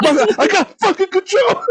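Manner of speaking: high-pitched, laughing insistence; laughing protest. Real, not acted. Defending himself to friends about yelling at movies, laughing protest. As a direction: insist indignantly while cracking up.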